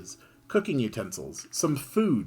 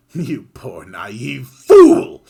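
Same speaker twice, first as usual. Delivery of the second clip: very loud, slow taunting gloat; villain's gloat; 2 semitones higher, 17 dB louder, much slower than usual. Acted. Griffin taunting Kid, very loud and slow. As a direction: gloat over the fool.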